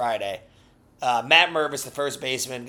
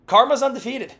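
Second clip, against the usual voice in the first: gleeful; 9 semitones higher, 6 dB louder than usual. Acted. Gleeful verdict.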